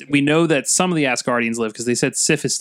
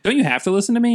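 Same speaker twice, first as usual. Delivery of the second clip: higher quoting voice; 10 semitones higher, much faster than usual. Retold or imitated. Acted Tony quote.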